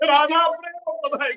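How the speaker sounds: high-pitched hammed-up lament; mock wailing. Kalavali keeps pleading at Jatila's feet, mock wailing. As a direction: plead in a fake tearful whine. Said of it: "starts wailing and crying profusely".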